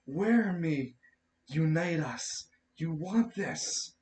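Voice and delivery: Anatar. Acted. Anatar urging the wearer to put it on, slow commanding voice. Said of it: "you hear Anatar speak directly".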